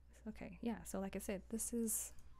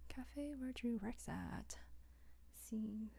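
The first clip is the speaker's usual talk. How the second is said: slow breathy whisper; whispering to self; 3 semitones higher, 10 dB quieter, much slower than usual. Real, not acted. Writer reading a scene heading to herself, slow whisper. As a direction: mouth the words slowly to yourself.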